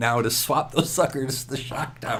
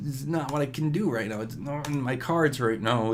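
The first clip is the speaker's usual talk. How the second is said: usual speaking voice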